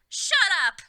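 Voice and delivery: very high, very loud roar. A narrator's reading. Lumpkin silencing the room, full roar. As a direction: blast it at everyone. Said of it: "Lumpkin roared".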